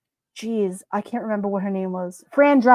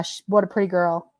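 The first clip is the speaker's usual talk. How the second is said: normal talking voice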